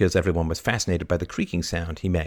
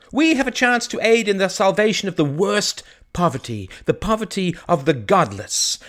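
impassioned sermon, pitched up and urgent; fervent preaching; 13 semitones higher, 6 dB louder than usual. Acted. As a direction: preach it like a village priest saving souls. Priest preaching to the village.

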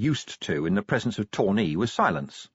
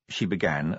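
usual speaking voice

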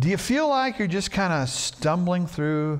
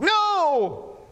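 put-on high defiant child shriek; toddler screaming no. Toddler refusing in story.

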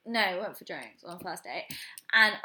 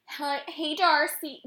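higher-pitched, campy imitation of a greeting; mock campy; 6 semitones higher, slower than usual. Imitating a date greeting her on their walk, mock campy. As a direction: imitate a camp American-accented man. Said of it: "he was so campy".

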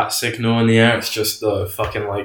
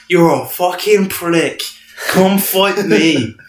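slow, loud, high Irish trash talk; Conor McGregor voice; 8 semitones higher, 5 dB louder, much slower than usual. Retold or imitated. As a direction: taunt like a cocky Irish fighter. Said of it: "going up to the Joker going".

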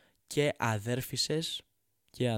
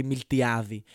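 louder scolding repeat; 7 dB louder than usual. Real, not acted. Comedian scolding hot coffee drinkers, loud and mocking. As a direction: hammer the summer point home loudly.

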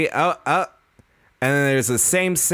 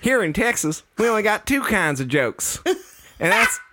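put-on Texan accent; 10 semitones higher than usual. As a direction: play a corny Texan comic. Mock Texan comedian bit.

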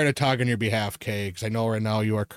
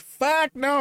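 sudden high yelp of refusal; a shrieked fuck no at spiders; panicked refusal; 13 semitones higher than usual. Retold or imitated. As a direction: squeal it out in alarm.

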